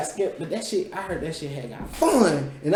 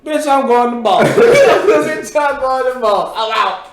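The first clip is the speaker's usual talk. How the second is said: loud, high, laughing boast; defiant boast; 9 semitones higher, 9 dB louder, slower than usual. Retold or imitated. Speaker retells announcing the new school, loud defiant boast. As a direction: crow it out through laughter.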